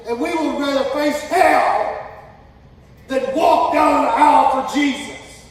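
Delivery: loud, slow, full-force preaching. Real, not acted. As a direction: hammer home the point slowly. Sermon climax.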